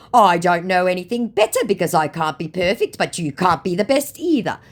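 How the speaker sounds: high, loud imitated protest; hammed-up comeback from an affronted scammer; mock defensiveness. Acts out scammer's defensive reply.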